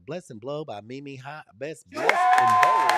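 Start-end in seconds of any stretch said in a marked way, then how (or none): 1.6-2.9 big hype call. Real, not acted. Livestream host hypes greeting.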